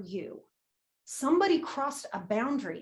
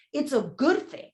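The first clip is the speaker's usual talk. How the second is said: ordinary talk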